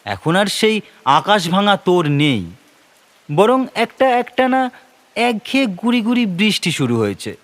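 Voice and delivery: usual speaking voice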